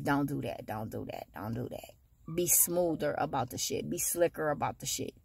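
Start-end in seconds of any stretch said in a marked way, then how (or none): 0.0-1.9 fading to a murmur. Shown as a demonstration.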